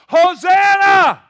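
huge, high, slow drawn-out Hosanna; crowd's roaring Hosanna; shouting. Acted. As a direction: belt it to the back row. Preacher shouts the crowd's cry.

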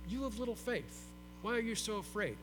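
soft, sleepy murmur; barely awake, mumbling it gently; just woke up. Acted. Jesus just woken in the boat, soft and drowsy. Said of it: "He probably woke up".

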